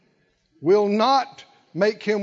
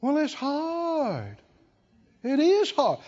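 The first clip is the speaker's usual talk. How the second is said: high, slow mock whine; mock complaining it's hard; whiny sad voice; 5 semitones higher, slower than usual. Shown as a demonstration. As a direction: sigh it out like a self-pitying sufferer. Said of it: "in the tone of the voice, in the sad looks".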